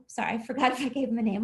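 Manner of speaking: ordinary talk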